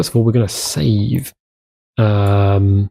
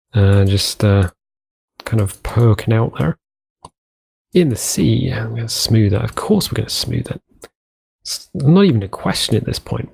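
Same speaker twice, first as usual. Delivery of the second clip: usual speaking voice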